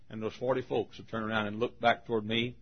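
usual speaking voice

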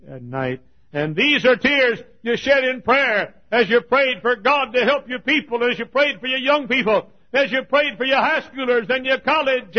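loud and high, rushing, then a ringing question; preaching at full force. Acted. Preacher to his congregation, asking about the ringed men, preaching loudly. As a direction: cry it out over the crowd. Tears shed in prayer.

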